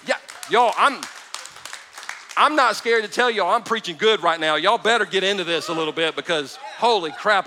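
ordinary talk

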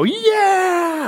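high rousing mock cheer; a hint of a rebel crowd's yell; crowd battle cry. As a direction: cheer like a crowd storming the gates. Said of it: "all the people would go".

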